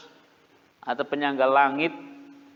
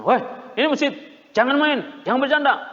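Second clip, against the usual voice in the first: put-on higher, louder harsh yell at children; snapping at kids; 8 semitones higher, 6 dB louder, faster than usual. Shown as a demonstration. Preacher showing the wrong way to scold mosque kids, shouting. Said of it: "Tapi jangan dibentak ya".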